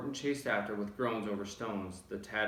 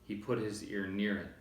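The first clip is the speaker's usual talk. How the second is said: ordinary delivery, nothing marked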